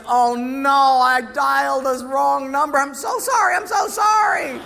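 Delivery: high, loud embarrassed cry; mortified apology. Retold or imitated. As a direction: blurt out the apology in horror. Caller realizes wrong number.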